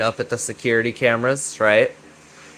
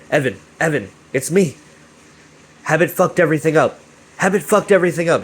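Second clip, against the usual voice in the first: higher, faster frantic plea; desperate pleading; 4 semitones higher, faster than usual. Retold or imitated. Vinny begging Evan after being tackled, frantic plea. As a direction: beg him to listen, panicking. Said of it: "Vinny's like".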